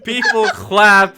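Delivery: slow, high, loud announcer's wrap-up; grand closing narration. Acted. Game master closing the story for the audience, slow and grand. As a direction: roll the credits like a trailer voice, drawn out.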